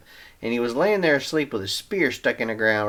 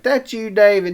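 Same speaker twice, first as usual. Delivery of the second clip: calling out; 11 semitones higher, 8 dB louder than usual. Acted. Saul calling out to David.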